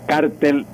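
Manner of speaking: "cártel" said CAR-tel, stress up front; first-syllable stress. Shown as a demonstration. Language teacher introducing the Spanish usage, CAR stressed in "cártel". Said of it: "se utiliza mucho en España cártel".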